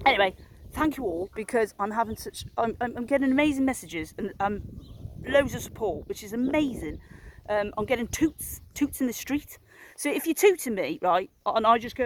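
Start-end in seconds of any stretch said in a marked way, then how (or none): none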